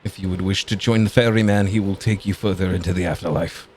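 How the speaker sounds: lightly voiced, courteous guide; polite attendant. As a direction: apologize smoothly like a polite attendant. Game master voices a polite attendant.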